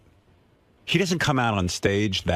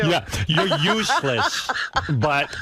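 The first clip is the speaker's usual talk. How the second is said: laughing; 7 semitones higher, slower than usual. Real, not acted. Joking while laughing.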